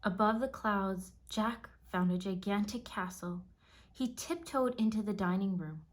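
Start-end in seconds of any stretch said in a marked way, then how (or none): none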